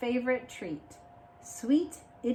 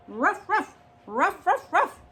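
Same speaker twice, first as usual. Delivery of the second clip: higher excited barks; mock chasing dog barks; dog barking; 8 semitones higher, 4 dB louder than usual. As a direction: bark while chasing round the tree.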